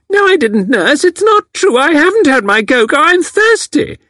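put-on calling out very high and loud like a tiny old lady; demanding cocoa in a high, loud, childish wail; little old woman loudly calling for her cocoa; loud shrill old woman. A narrator's reading. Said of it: "loud, shrill voice".

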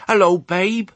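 high, loud sheep's greeting; excited ewe calling hello; crying out. A narrator's reading. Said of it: "they cried".

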